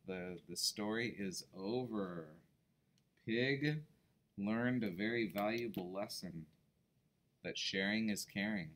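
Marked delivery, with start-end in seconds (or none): none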